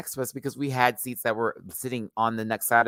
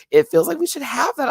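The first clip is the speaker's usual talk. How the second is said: excited agreement; 7 dB louder than usual. Retold or imitated. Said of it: "It's like".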